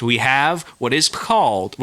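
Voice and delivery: ordinary talk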